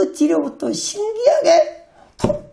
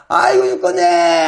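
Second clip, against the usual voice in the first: drawn-out, higher imitation of someone wailing in pain; hammed-up cry of agony, slowly stretched; mock agonized wail; 4 semitones higher, 3 dB louder than usual. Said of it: "악을 쓰던 사람이".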